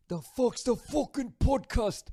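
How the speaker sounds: high, slow, half-sung mock Scottish accent; a clumsy put-on Scottish accent, drawn out; bad Scottish accent. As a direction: ham up a bad Scottish brogue. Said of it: "sorry for the bad accent".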